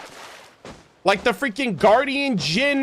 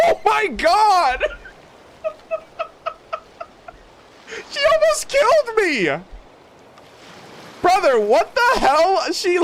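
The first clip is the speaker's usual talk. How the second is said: shocked laughter; 7 semitones higher than usual. Real, not acted. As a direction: laugh in shock after a near miss. Near death, laughing in shock.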